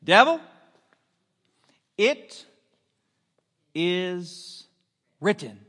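a loud "Devil" with slow, spaced "it is written"; emphatic proclamation. Shown as a demonstration. Preacher teaching the congregation a reply to the devil, slow and emphatic. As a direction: hold a pause before each word of "it is written". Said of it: "here's what we say".

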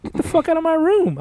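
high-pitched, indignant demand; mock outraged outburst; retold angry order. Retold yelling at cop on waking.

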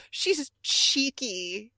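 imitating a slow, leering Wesley. Mocking how Wesley ogles the student, slow leer. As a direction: draw it out like a creepy teacher savoring it. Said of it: "the way he says".

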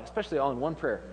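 normal talking voice

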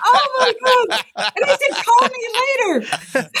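mother laughing at her own advice. Retold or imitated. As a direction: laugh while you say it.

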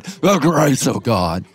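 slow, hammed-up pulpit intonation; imitating an old-time preacher, slow; drawn-out old preacher cadence. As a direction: stretch the grace of God with old-time pulpit weight. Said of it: "That's how pastors used to preach".

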